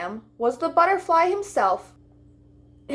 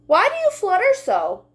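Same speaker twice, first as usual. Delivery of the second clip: high lamb voice; 9 semitones higher, 4 dB louder than usual. Acted. Lamb asks, much higher.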